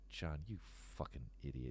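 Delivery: low, hushed mock scolding; quiet put-down in an imitated dad's voice; imitating friend's dad. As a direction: mutter it low, as a disappointed dad. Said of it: "He's like".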